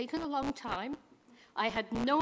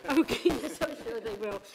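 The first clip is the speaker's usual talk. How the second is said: laughing out the words; 4 dB louder than usual. Real, not acted. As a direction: say it while still laughing. Laughing at her own joke.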